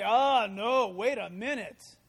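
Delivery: loud "oh, no" sinking into a slow refusal; hammed-up reluctant objection; mock balking. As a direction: dig your heels in, drawing out the words. Voices refusal Philip doesn't say.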